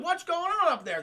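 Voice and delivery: high-pitched police call. Acted. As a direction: shout up to find out what is wrong. Police shout up at noise.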